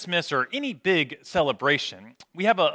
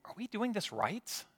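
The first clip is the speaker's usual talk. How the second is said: very soft, higher-pitched worried question; hushed haunting question; 6 semitones higher, 12 dB quieter than usual. Acted. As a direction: ask it under your breath, pitched up. Voicing a haunting inner question.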